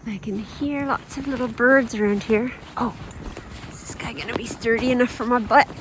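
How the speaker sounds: ordinary talk, nothing marked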